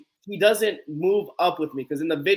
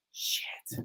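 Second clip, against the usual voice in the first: voiceless, deflated whisper; whispered curse; 14 dB quieter than usual. Retold or imitated. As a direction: curse under your breath in retold dismay. Winded reaction to being asked again.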